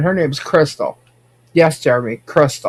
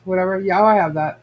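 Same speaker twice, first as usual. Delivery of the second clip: ordinary delivery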